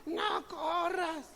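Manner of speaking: high, very slow drawn-out plea; joints crying out. Acted. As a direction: let the sore knees beg, stretched and high. Aching joints cry out.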